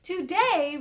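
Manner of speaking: ordinary delivery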